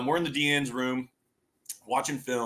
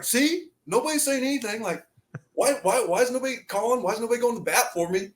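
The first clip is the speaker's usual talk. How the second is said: high, protesting quoted voice; pleading mock protest; retold exasperated complaint; 9 semitones higher than usual. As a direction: keep asking why, high and aggrieved, as retold.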